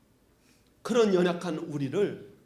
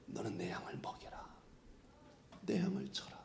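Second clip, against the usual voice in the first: soft unvoiced whisper, much softer; tender whisper; 18 dB quieter than usual. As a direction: whisper it tenderly like God to Peter. God's tender words to Peter. (부드럽게 속삭이는 하나님의 목소리)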